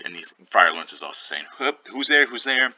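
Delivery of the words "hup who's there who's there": higher-pitched call to whoever is knocking; lightly acted "who's there?" at the door. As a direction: call out, pitched up, as a mock friar. Friar calls out to knocking.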